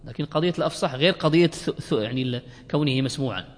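ordinary delivery

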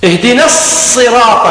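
slow, long held S in الصراط; shadda on the sad. Shown as a demonstration. Teacher counts the shaddas, doubled sad in الصراط. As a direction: hold the emphatic S of الصراط. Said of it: "لا بد من التشديد".

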